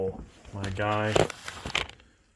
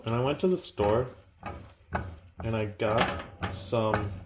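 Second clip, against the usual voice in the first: normal talking voice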